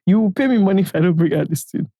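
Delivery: ordinary talk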